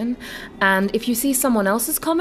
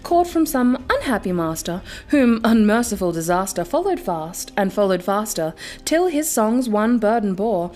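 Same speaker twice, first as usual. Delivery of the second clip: measured, brooding recital of a gothic poem; dramatic poem reading; 4 semitones higher than usual. A narrator's reading. Reading a favorite poem to viewers, dramatic recital. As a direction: read it like a candlelit recital. Reads The Raven aloud.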